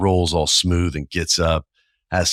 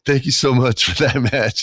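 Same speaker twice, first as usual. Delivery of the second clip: grateful backstage crew; 7 semitones higher, 3 dB louder than usual. Retold or imitated. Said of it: "they're like, probably like".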